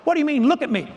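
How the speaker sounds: high-pitched, affronted comeback; indignant retort. Retold or imitated. Teacher answering mentor's criticism of the model, mock indignant. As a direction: retort as if insulted, playing it up.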